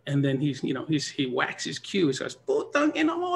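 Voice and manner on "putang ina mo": high sharp Tagalog curse; angry curse. Retold or imitated. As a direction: spit out the curse in frustration. Angry opponent curses, whacks cue.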